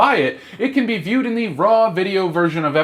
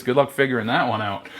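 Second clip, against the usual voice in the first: usual speaking voice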